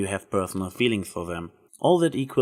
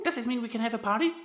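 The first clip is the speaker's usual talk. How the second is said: high, excited pony chatter, softer; bubbly party pony; Pinkie Pie voice; 13 semitones higher, 6 dB quieter, faster than usual. Acted. Pinkie eagerly asks Trixie about a party, high and bubbly.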